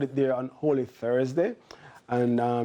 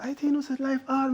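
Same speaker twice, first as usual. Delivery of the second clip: high mock voice of a lady; squeaky quoted lady; imitating a lady; 12 semitones higher than usual. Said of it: "a lady said".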